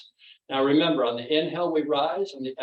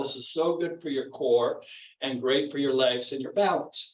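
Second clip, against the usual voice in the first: ordinary talk, nothing marked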